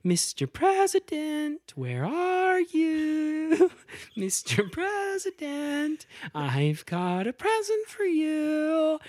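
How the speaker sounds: high, slow sing-song serial killer call; singsong. Retold or imitated. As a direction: coo it out eerily like a creepy killer. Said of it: "calling out in a sing-song voice".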